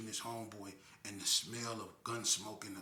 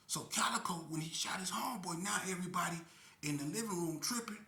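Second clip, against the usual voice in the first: normal talking voice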